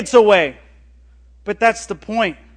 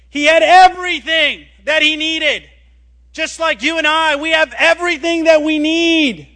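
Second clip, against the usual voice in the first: loud, high pulpit proclamation; preaching at full force; 8 semitones higher, 7 dB louder than usual. Acted. Preacher urges the congregation to stop compromising, loud proclaiming. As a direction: lift it up and proclaim it to the room.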